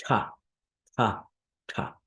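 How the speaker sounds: retroflex ta, tongue tip curled back; ta with the tongue curled behind the ridge; curled tongue heavy consonants. Shown as a demonstration. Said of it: "tongue is gonna curl back".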